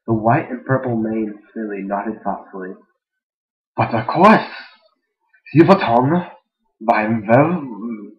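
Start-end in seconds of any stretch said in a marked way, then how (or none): 3.8-8.1 loud, hammed-up German accent. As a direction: play it as an exaggerated German. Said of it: "Give him German accents".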